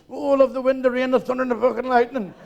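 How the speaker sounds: reaching the peak of the fake storm; hammed-up rapture. Climax of aroused client.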